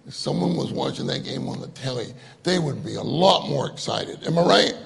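usual speaking voice

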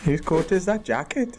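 put-on Welsh accent. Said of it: "do it in your best Welsh accent".

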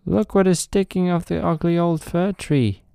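high, mocking little boy voice; gleeful child jeering at tree.

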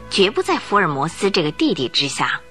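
normal talking voice

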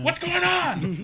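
high, loud, drawn-out mock yell; mom's yell. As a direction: imitate mom yelling, slow and high. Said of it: "she just yells".

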